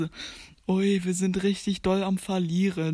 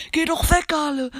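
higher, louder burst of protest; urgent shout; 6 semitones higher, 4 dB louder than usual. Real, not acted. Player yells at an enemy to go away, urgent shout. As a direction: shoo the opponent away loudly. Calls at game opponent to leave.

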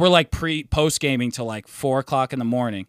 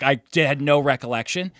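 normal talking voice

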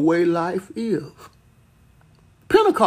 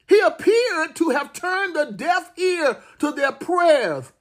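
impassioned preaching; 12 semitones higher than usual. Acted. Preacher pleading with his congregation, impassioned and rising. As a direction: build the sermon into a passionate plea.

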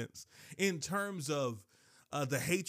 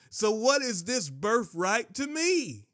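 raised, louder rhetorical protest; dismissive Esau; 6 semitones higher, 5 dB louder than usual. Acted. Famished Esau to Jacob about his birthright, loud dismissive protest. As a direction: toss the question out high and loud.

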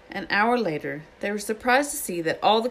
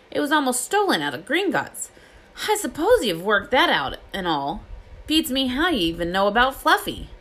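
loud, higher, emphatic character voice; Hagrid, firmly refusing; 5 semitones higher, 4 dB louder than usual. A narrator's reading. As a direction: lay down the reasons emphatically.

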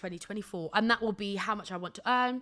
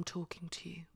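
whispering; 4 semitones lower, 11 dB quieter than usual. Real, not acted. Moves closer to address listeners.

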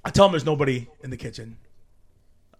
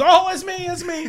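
mock excited shout; 13 semitones higher, 4 dB louder than usual. Drummer fantasy excitement.

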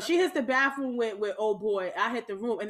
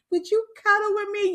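higher, louder teasing imitation; mock pleading; 9 semitones higher, 6 dB louder than usual. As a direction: imitate a needy lover, pitched up. Friend mockingly retells his request.

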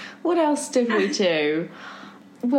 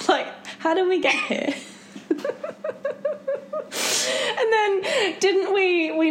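high, breaking laugh over the words; laughing helplessly; 11 semitones higher than usual. Real, not acted. Remembering the creepy blankets with her friend, laughing. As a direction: let the laughter take over.

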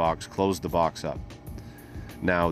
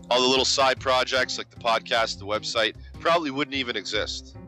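higher, upbeat sponsor pitch; sponsor announcer; 5 semitones higher than usual. Acted. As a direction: roll it out like a hype announcer. Hyped sponsor read segment.

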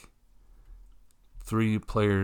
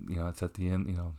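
ordinary talk

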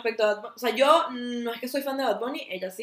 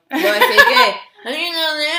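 stretched out slowly through giggles, higher and louder; can't get "Lo decía y que" out for laughing; slow giggling repeat of "Lo decía y que"; 6 semitones higher, 8 dB louder, much slower than usual. Real, not acted. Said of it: "perdón, pues".

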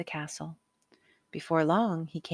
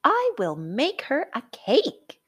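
put-on higher, louder eager boy's voice; boy declaring; 8 semitones higher, 5 dB louder than usual. A narrator's reading. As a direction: announce it proudly like a young boy. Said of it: "he declared".

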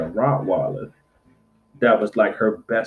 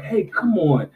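higher-pitched call to a dog; retold call to come along; 7 semitones higher than usual. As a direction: coax a dog over. Calling a loose dog over.

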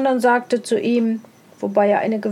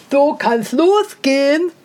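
a lightly voiced sneering hare; scornful hare; 2 semitones higher, 4 dB louder than usual. A narrator's reading. The hare mocks the hedgehog's crooked legs, lightly sneering. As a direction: look down on him as a lightly voiced smug hare.

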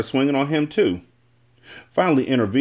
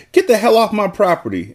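high, forceful order; acted scream; 9 semitones higher, 4 dB louder than usual. A narrator's reading. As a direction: scream it as a narrator would, slightly held back. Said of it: "Annalise screamed".

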